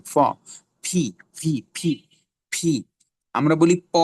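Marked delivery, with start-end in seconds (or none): 0.8-2.8 short separate ফি, P airy like ফ; aspirated P. Shown as a demonstration. Teacher drilling the letter P, aspirated. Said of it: "পি এর উচ্চারণ ফ এর মতো হবে".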